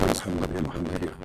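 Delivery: very soft, distant-sounding call; a far-off angel voice; ghostly angel voice. Acted. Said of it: "The voice goes".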